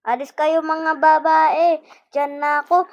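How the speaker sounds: loud, slowed yell to move; loud playful yell. Acted. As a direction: shout them out of the way. Yelling at game characters.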